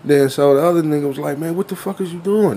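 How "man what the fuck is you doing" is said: raised-pitch mock kid indignation; imitated street kid. Eight-to-ten-year-old scolding his friend, imitated indignation. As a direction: play the exasperated child, pitched up. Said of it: "This is how he's talking".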